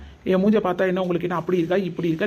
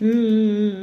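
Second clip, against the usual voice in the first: loud put-on whimpering sobs; hammed-up sob hums; fake sobbing; 3 semitones higher, 6 dB louder, much slower than usual. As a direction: wail for show so the husband comforts her. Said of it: "ஏம்மா இப்படி அழுவுற".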